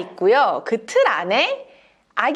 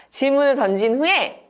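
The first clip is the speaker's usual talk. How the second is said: ordinary delivery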